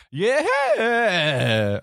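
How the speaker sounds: sudden high cracked squeal of a yeah. Retold or imitated. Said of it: "I did that squeal bit".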